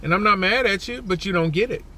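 ordinary talk, nothing marked

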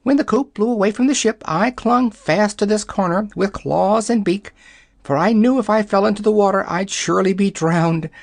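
high, quick fussy hen voice; a hen rattling off her ordeal; chattering yellow hen. Acted. Hen tells her night.

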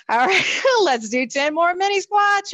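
laughing. Real, not acted. Instructor laughing mid-instruction.

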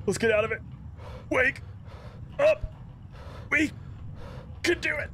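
high, panicked pleading in real fear; begging for it to stop, voice way up; scared pleading. Real, not acted. Player stuck in the nightmare, begging it to stop, panicked and high. Trapped in sleep paralysis, pleading.

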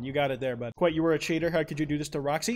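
usual speaking voice

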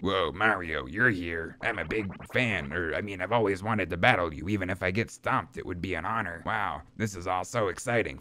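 low, hurried fanboy henchman; low-voiced starstruck underling; minion fan, awed. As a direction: gush shyly in a low put-on voice. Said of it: "I should give them all different voices".

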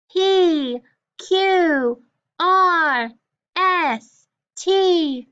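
high, loud letter chant. Shown as a demonstration. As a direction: call out each letter loudly, high and falling. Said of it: "say the consonants loud".

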